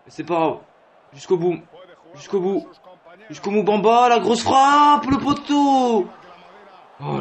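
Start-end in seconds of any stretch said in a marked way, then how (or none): 1.1-4.1 from mildly up to high and loud; growing excited cheer; building urging. Real, not acted. Urging player on, repeated. 4.1-6.1 very high, loud, slow cry; drawn-out anguished cheer; excited shout. Real, not acted. Shot hits the post.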